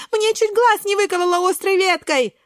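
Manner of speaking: high, squeaky complaint. A narrator's reading. Брусничка nearly poked in the eye, high squeaky cry. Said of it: "пищит Брусничка".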